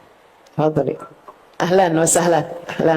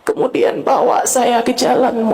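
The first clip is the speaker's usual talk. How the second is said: impassioned prayer; 7 semitones higher than usual. Acted. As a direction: cry out to God with full force.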